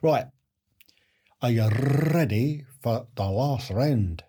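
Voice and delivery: put-on slow Scottish accent with rolled R; best Scottish accent. Quizmaster announcing the last round, put-on Scottish accent. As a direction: put on a hammy Scottish burr, drawn out. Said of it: "That's my best Scottish accent".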